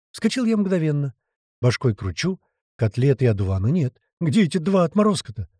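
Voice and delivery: ordinary delivery, nothing marked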